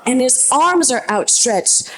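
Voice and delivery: ordinary talk, nothing marked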